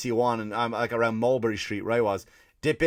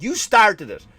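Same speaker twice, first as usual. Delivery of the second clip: higher, louder indignant telling-off; angry retort; 6 semitones higher, 5 dB louder than usual. Retold or imitated. Comedian yelling at an Italian waiter, indignant and loud. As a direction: shout back at the waiter, outraged. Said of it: "I'm like".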